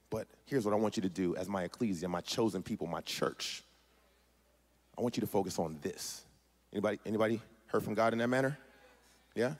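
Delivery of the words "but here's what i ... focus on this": very soft, low, measured; hushed voice of God. Acted. God instructing his chosen church, hushed and low. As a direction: drop right down to an intimate hush.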